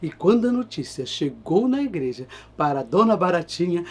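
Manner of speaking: ordinary delivery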